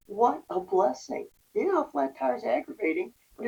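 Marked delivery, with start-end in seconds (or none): 0.1-1.3 slow grateful repeat. Retold or imitated. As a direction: let the relief sink in. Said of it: "I remember thinking to myself".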